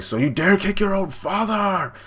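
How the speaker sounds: high, drawn-out mock bellow; slow, theatrical outrage; outraged father. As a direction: act out an indignant father, pitch soaring. Father angrily confronts his son.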